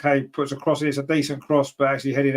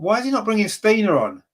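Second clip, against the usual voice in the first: higher, baffled outburst at the manager; exasperated question; 6 semitones higher than usual. Real, not acted. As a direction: throw the question up in disbelief. Fan frustrated about substitutions.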